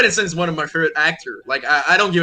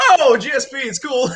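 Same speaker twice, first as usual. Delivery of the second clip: mock American fan; 8 semitones higher than usual. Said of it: "all the American is just like".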